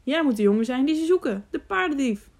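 narrated exclamation, rising in pitch at the end; exclaimed. A narrator's reading. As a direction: call it out as a sudden recognition. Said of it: "riep hij tenslotte".

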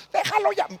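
grito muy agudo y lento; grito de madre. Retold or imitated. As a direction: grita desesperada para que pare. Madre grita de nuevo. (madre grita al padre que suelte al hijo)

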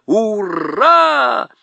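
a joyous group cheer; whole room cheering the plan. A narrator's reading. Everyone cheers.

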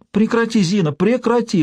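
высокая отчаянная мольба; умоляй брата остановиться. A narrator's reading. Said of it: "взмолилась". (отчаянная мольба)

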